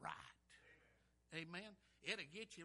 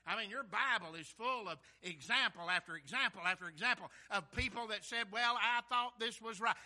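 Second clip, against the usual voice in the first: loud, insistent pulpit delivery; full-force preaching; 3 semitones higher, 11 dB louder than usual. Real, not acted. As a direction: hammer the warning home to the congregation.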